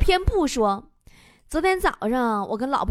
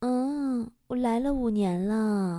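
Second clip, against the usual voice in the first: soft, low, slow answer; a hint of a meek nurse; lightly voiced timid nurse; 3 semitones lower, 6 dB quieter, much slower than usual. Nurse answering the patient, meek and drawn out.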